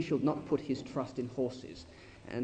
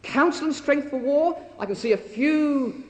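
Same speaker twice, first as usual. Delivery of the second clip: mocking taunt; 9 semitones higher, 6 dB louder than usual. Preacher voicing the mocking Rabshakeh.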